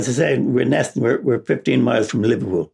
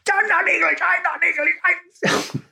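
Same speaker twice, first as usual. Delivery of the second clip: high, slow indignant protest; indignant boy; slower than usual. Retold or imitated. Boy protesting to taunting cousins, high and wounded. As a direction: wail it like a cornered kid. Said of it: "they infuriated me".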